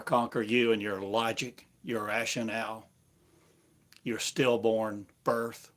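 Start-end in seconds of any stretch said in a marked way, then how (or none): none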